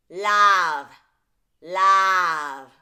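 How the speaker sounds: "Love" said loud and nasal as "lav" with a wide flat vowel; splatted nasal vowel. Shown as a demonstration. Coach imitates nasal singers saying "Love" as "lav". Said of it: "they usually pronounce it this way".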